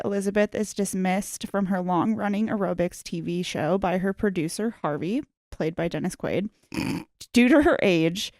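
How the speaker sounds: ordinary talk, nothing marked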